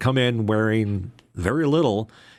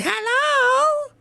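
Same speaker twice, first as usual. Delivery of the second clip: put-on very high, squeaky little girl hello; little niece; 4 dB louder than usual. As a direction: chirp it like a small child. Niece answers phone, voiced.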